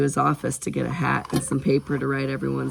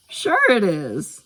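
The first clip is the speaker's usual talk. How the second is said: put-on higher, louder, drawn-out kid's retort; slow, high, confident comeback; wrong character's voice; 7 semitones higher, 5 dB louder, slower than usual. A narrator's reading. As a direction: voice it as Taika, the excitable kid. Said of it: "I was doing Taika".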